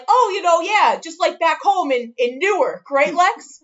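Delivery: high teasing mock Jersey talk; imitating a teasing friend; mocking coworker. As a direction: needle her in a high, singsong tease. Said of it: "to say things to me like".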